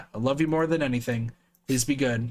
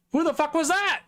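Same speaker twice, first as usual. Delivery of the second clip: shocked; 14 semitones higher than usual. Real, not acted. Shocked outburst, far voice.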